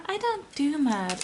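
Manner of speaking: high Southern-accented fairy imitation; garden fairy's Southern accent. Fan repeats her favorite fairy's line, Southern accent. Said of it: "it's the same accent as in".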